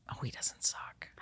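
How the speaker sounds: hushed aside, with a small gasp; imitating a whispered reaction at a comedy show. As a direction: whisper it as a private surprised thought. Retells a private reaction at a show.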